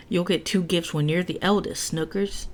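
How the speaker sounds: ordinary delivery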